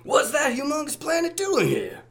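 squeaky bad Jazz impression. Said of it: "That was bad jazz".

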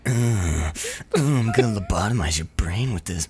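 low creepy doctor. Retold or imitated. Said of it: "I was doing the doctor voice".

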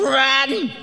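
the body's instinct yelling to flee; mock scream. Preacher imagining every fiber screaming to run, mock panicked yell. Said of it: "would scream".

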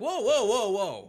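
loud, high alarmed whoa; startled whoa. Retold or imitated. Reader backing away from the seller, loud and startled. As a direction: back off fast, loudly startled.

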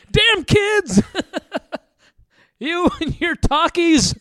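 put-on high, laughing cranky old-timer; old prospector. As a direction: grumble like an old geezer.

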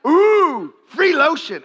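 high, loud fake delight; mock delighted squeal. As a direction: squeal over the free lotion. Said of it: "And then you're like".

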